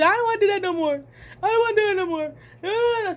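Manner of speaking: high, fast, panicky whimper; freaking-out sister. Retold or imitated. Sister still crying after the chase, high quick panicked pleading. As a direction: babble it like a scared kid. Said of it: "She's still freaking".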